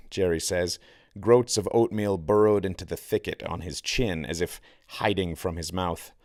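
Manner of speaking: ordinary delivery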